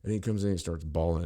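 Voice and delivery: ordinary talk, nothing marked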